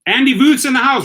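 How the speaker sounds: loud high welcome call; excited greeting. Real, not acted. Host welcoming Andy into the live stream, loud excited call. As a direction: announce him like a hype man.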